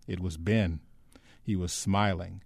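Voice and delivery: ordinary delivery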